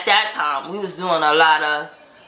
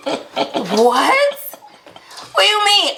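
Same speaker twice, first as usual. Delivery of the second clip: laughing disbelief; 10 semitones higher, slower than usual. Retold or imitated. Retelling her own baffled reaction.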